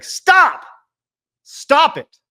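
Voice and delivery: high, loud stop it; high loud mock protest. Real, not acted. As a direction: shout it down, half joking. Streamer protesting chat message.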